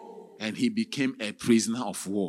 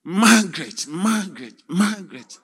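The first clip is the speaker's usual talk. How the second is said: pleading calls; 5 semitones higher, 7 dB louder than usual. Acted. Husband calling through the door.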